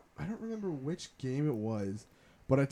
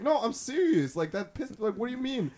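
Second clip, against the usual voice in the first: high indignant protest; high mock protest; 9 semitones higher than usual. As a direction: protest it, half laughing. Arguing with brother, retold.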